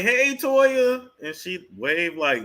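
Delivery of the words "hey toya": high, loud fan-style hello; eager greeting call. Retold or imitated. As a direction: call out eagerly to someone passing by. Retells calling to Toya jogging past.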